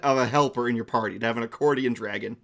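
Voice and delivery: ordinary talk, nothing marked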